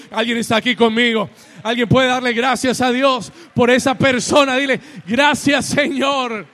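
loud, high, driving sermon shout; rousing preacher building to a peak; full-force preaching. Real, not acted. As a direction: preach it at full volume, line after line.

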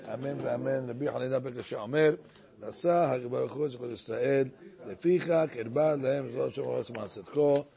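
low, soft prayerful chanting; slowing at the end, a quiet blessing; prayer recitation. Acted. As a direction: recite it gently like a closing prayer.

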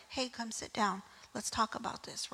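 a friend quietly calling you to sit; gentle hush. Retold or imitated.

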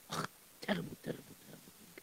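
whispered, fading dismay; hushed lament. Acted. Shamas mourning the shoemaker under his breath, whispered.

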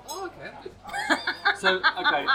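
high laughing relief. Real, not acted. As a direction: giggle through it, pleased. Laughs after tongue twister.

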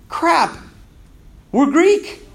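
high-pitched, dismayed discovery; dawning realization. Acted. Conquered people wake up converted, mock-startled. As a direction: let it dawn on you, pitch lifted.

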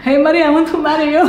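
laughing through a fan's proposal; laughing mock proposal.

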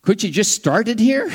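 imagined listener's question. Retold or imitated. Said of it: "And I'm sure you're thinking".

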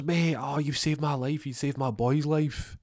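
high, overexcited thanks; gushing gratitude. Acted. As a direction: gush at the man who saved you. Acted gushing thanks to rescuer.

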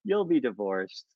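soft, slow, offhand pronouncement; flippant. Retold or imitated. Palm reader glances at a hand and answers, flippantly. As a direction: imitate a palm reader tossing out a verdict. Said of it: "He just very flippantly said".

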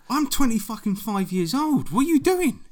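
high, loud disbelief; incredulous outburst. Real, not acted. To the listener, loud disbelief at the club invite. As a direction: exclaim it in shock, voice up high.

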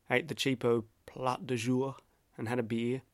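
ordinary talk

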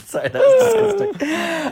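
high, loud helpless laughter through the words; real laughter, near tears; laughing. Real, not acted. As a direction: laugh so hard the apology barely comes out. Cracking up at own joke.

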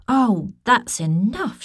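slow, loud, high yell. Acted. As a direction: shout it out, fed up. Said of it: "she yelled".

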